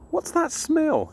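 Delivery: put-on a small girl sniffing the night air, curious; curious little girl. Ella asks her mum.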